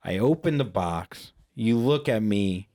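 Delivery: usual speaking voice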